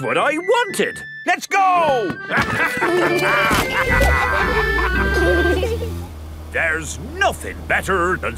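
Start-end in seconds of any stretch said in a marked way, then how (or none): 2.2-6.5 hearty laugh. Acted. Captain delighted about sailing.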